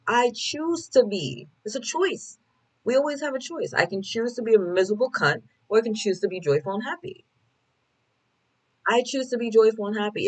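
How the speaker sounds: upbeat declaration. Retold or imitated. Telling the bus driver she chooses joy, high and emphatic. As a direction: sing it out like you mean it. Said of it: "I'm like".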